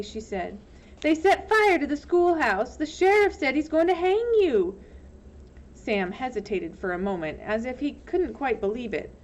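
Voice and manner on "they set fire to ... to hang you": high urgent alarm. Acted. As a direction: warn him fast. Telling Sam danger.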